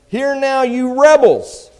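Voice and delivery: high, very loud, drawn-out cry; shouted rebuke. Acted. Moses confronting the assembled rebels, shouted rebuke.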